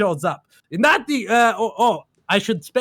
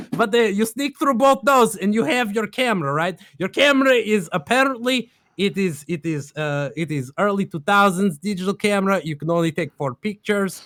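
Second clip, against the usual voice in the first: ordinary talk, nothing marked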